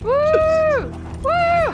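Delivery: high, loud cheering woo; delighted high whoops; excited whooping. Real, not acted. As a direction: whoop with glee while flying. Flying, cheering.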